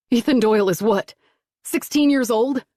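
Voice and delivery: disgusted, rising high and loud. A narrator's reading. Father questioning the agent about Ethan, disgusted. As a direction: spit out the age in disgust. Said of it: "Kevin asked with disgust".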